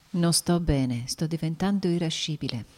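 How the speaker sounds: ordinary delivery, nothing marked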